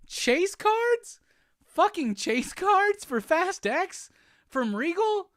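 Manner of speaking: very high, stretched indignant questions; squeaky disbelief. Real, not acted. To the viewers, chase cards from Regal, squeaky disbelief. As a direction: squeal it in mock horror.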